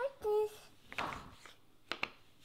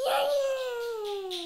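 excited cheer; 4 semitones higher, 5 dB louder than usual. Real, not acted. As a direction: shout it out with joy. Toddler cheering.

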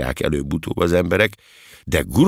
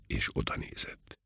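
plain reading voice

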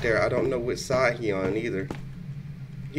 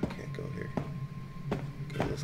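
unvoiced frightened whisper; 16 dB quieter, faster than usual. Real, not acted. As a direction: breathe it out scared. Repeated as whisper.

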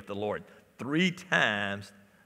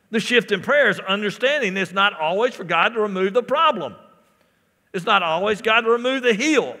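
raised, driving sermon delivery; fervent pulpit voice, higher and louder; full-force preaching; 8 semitones higher, 9 dB louder than usual. Real, not acted. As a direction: preach it loud, punching the key words.

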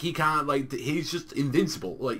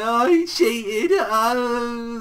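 mock crying whine, high and loud; Champa; 8 semitones higher, 6 dB louder, much slower than usual. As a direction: wail it like a sore loser, hammed-up. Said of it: "you see Champa crying and whining".

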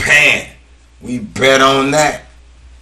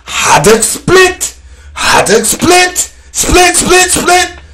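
hype call; 14 semitones higher than usual. Real, not acted. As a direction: chant it like a fan in the stands. Hyping up a team name.

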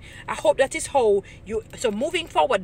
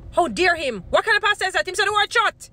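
hammed-up scandalised voice, higher and louder, "shut." snapped out; mock outrage; 9 semitones higher, 5 dB louder than usual. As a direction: play the outraged churchgoer over the top. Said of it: "this is how we're gonna be watching the pastors".

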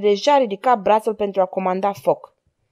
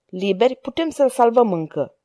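ordinary delivery, nothing marked

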